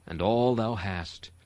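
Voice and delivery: ordinary talk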